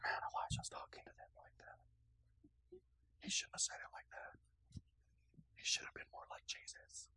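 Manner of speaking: hushed, voiceless aside; scandalized whisper. Acted. Onlookers secretly criticizing Elijah's mockery, scandalized whisper. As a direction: whisper it like a disapproving bystander. Acts out shocked onlookers muttering.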